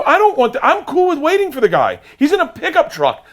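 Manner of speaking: loud, high-pitched pleading argument; frantic protest. Retold or imitated. Husband to wife about waiting in line, frantic protest.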